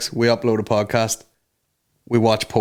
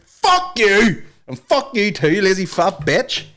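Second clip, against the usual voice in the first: higher hammed-up angry insults; mock angry rant; 8 semitones higher, slower than usual. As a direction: play an angry boss cursing. Michael Jordan voiced angrily.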